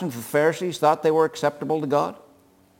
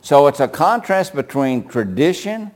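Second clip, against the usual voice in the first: ordinary talk, nothing marked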